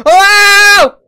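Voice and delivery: yelping in surprise as the clown appears; startled whoa. Real, not acted. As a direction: jump out of your skin. Chased by killer clown.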